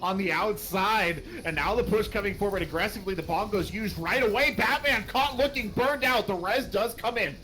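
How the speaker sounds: loud, high fight commentary; excited play-by-play. Acted. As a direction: call the fight as it explodes. Live play-by-play of a teamfight.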